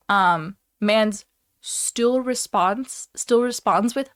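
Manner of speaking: usual speaking voice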